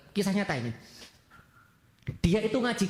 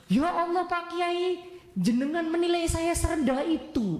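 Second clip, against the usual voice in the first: high, drawn-out hurt protest; acted offended plea, pitched high; wounded indignation; 8 semitones higher, slower than usual. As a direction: protest as if deeply insulted, hammed-up. Santri offended by marriage offer.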